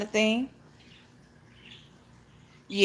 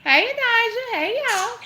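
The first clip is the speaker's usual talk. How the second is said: much higher, louder hello; sing-song greeting; 13 semitones higher, 4 dB louder than usual. Real, not acted. Welcoming Elijah to the stream, high loud sing-song hello. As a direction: call the name out up high.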